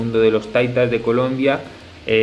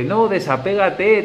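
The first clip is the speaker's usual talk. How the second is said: higher, drawn-out mock urging; imitated advice; 8 semitones higher, slower than usual. People telling the speaker to detach, imitated urging. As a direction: lightly mimic people pushing their advice. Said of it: "La gente me dice".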